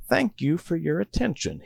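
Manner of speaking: lightly played sarcasm at the distracted group; sarcastic. A narrator's reading. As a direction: drip sarcasm on the thanks. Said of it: "he says sarcastically".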